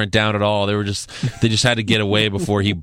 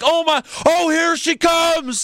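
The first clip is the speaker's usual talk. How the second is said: much higher, slow and swooning; gushing commentator; slower than usual. Retold or imitated. Mocking Corey Graves gushing as Mandy Rose is tagged in, swooning. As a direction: ham up a commentator gasping over her entrance. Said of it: "he was like".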